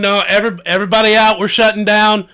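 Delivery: loud, high, hurried shout; urgent, high-pitched closing-up yell; shop owner shooing customers out. Acted. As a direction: shout them all out of the shop.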